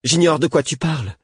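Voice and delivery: exclamation aiguë et indignée; furieuse. A narrator's reading. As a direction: protester avec colère, voix montée. (exclamation furieuse)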